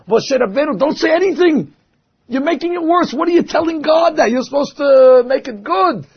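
an exasperated outcry; rabbis protesting. Retold or imitated.

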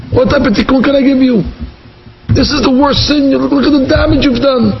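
high, exasperated retold scolding; indignant rebuke. A rabbi answers the butchers begging for a tikkun, indignant rebuke. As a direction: scold them in disbelief, voice pitched high.

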